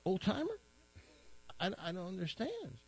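a hint of a kind policeman checking on him; concerned policeman. Policeman asks the crying old man what's wrong, lightly concerned. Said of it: "He says".

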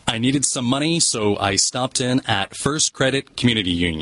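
a British speaker doing a passable American accent; careful American accent attempt. Shown as a demonstration. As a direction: flatten the vowels and land the R's like an American. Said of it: "that was a lot more, uh, American".

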